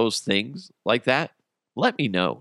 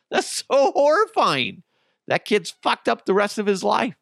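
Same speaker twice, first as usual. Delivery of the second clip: ordinary delivery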